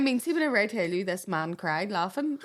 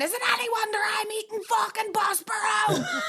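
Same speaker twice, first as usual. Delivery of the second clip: laughing mock shout; 10 semitones higher than usual. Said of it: "right on the end of the scream".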